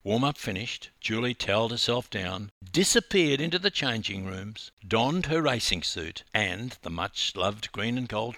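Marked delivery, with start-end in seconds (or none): none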